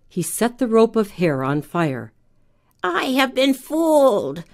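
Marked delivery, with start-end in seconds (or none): 2.8-4.4 high, lightly voiced shocked witch; a hint of a dismayed old witch; crying witch. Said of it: "the witch cried".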